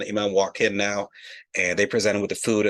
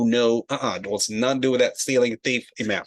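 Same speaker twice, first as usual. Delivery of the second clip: ordinary talk, nothing marked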